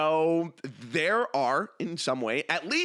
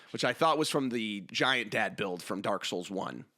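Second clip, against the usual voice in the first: ordinary delivery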